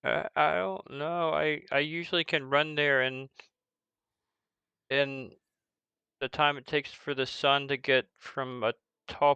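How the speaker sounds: lightly voiced, halting kid; unsure orphan child. Orphan guessing the distance to the orphanage, unsure. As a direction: puzzle it out haltingly.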